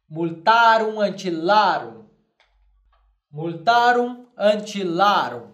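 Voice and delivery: deliberate, measured multarum antillarum; slow Latin model. Shown as a demonstration. Teacher modelling Latin plural slowly to class. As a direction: say it twice, clearly for copying. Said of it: "eu direi".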